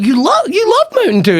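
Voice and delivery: squeaky high mocking Heather. Wife teasing him about Mountain Dew, high and mocking. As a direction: pitch it way up, teasing. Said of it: "Heather was like".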